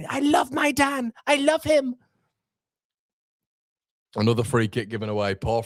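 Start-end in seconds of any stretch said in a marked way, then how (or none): 0.1-1.9 much higher put-on manager voice, doting; Daniel Farke impression. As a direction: gush over your player in a high mock voice. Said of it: "in the Daniel Farke impression".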